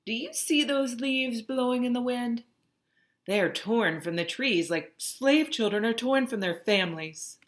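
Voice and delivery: a mother warning her son gently; mother's warning. Acted. Mother warning young Henry about being sold.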